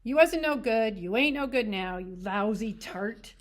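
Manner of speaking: vicious. A narrator's reading. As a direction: spit the words at her. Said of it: "he said viciously".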